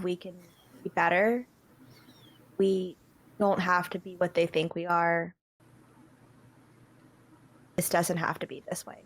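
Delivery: low, soft echo of her words; quoting Sukuna softly. Retold or imitated. Player repeats Sukuna's plea to the GM, low and soft. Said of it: "to repeat what she told me".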